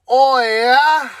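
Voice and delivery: high, drawn-out mock Canadian moan; Canadian impression. Ranter parodies a Canadian in a crude gag, high mock Canadian moan.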